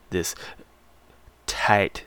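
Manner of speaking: ordinary talk, nothing marked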